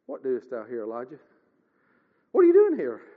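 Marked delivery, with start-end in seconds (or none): 0.1-1.2 hushed, much softer and lower, like a still small voice. A narrator's reading. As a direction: voice God's question gently from the quiet. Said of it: "a still small voice".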